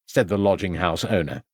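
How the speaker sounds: ordinary delivery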